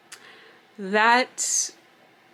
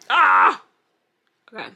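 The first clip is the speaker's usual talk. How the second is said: ordinary delivery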